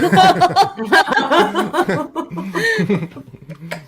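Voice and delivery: long, high burst of laughter; helpless laughter. Real, not acted. Cracked up by own slip of the tongue, helpless laughter.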